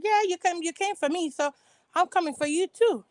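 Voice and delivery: high, snippy comeback; mock Ashley threat. Ashley snapping back at Karen, high and snippy. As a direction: fire it back like a catty rival. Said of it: "Ashley was like".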